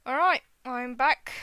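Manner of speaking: loud return after a break; changed voice. Real, not acted. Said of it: "my voice has changed a bit".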